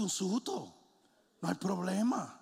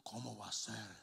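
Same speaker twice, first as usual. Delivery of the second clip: acted lament; 2 semitones lower than usual. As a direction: begin hushed, as if bewildered by loss. Acts disgruntled people complaining to God.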